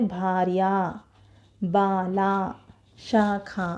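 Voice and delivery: भार्या, बाला, शाखा slowly, each ending on a long "aa"; long final आ. Shown as a demonstration. Teacher giving examples of words that end in आ, drawn-out final vowel. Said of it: "उस स्वर से आपका ध्वनि समाप्त होता है".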